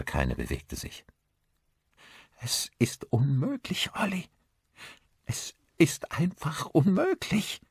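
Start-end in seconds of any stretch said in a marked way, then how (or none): 2.4-7.6 high, breathy, quavering disbelief. A narrator's reading. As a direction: voice shaking, pitch climbing in distress. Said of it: "Ihre Stimme bebte". (mit bebender, hoher Stimme)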